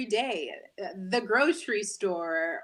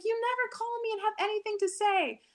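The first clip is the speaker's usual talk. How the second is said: high nagging mother voice, imitating; mock-scold like a Jamaican mom; mother's complaint in a high voice; complaining mom; 13 semitones higher than usual. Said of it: "And she's like".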